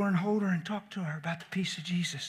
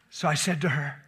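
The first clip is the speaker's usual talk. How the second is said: usual speaking voice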